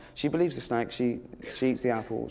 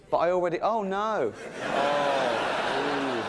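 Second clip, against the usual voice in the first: mock dismay; 2 semitones higher, slower than usual. Snake hears its crawling punishment, badly faked dismay. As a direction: feign horror at the sentence.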